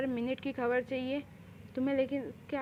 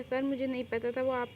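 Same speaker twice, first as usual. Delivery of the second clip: ordinary delivery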